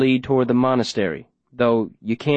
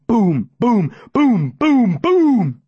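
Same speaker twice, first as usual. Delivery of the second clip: booms getting higher and louder; rising booms; 12 semitones higher, 5 dB louder, much slower than usual. A narrator's reading. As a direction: build each boom up to a shout. Five explosions.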